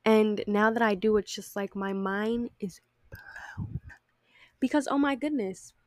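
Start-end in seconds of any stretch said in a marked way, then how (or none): 1.2-3.8 whispering. Real, not acted. Amazed aside about movement.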